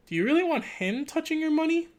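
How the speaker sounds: high-pitched theatrical question; mock poster voice.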